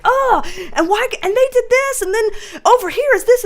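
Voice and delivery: high, breathless-run mock rant; passionate ranting. Host showing viewers their passionate talk, mistaken for mad. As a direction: rattle off complaints with hammed-up passion. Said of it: "being passionate and loud and like".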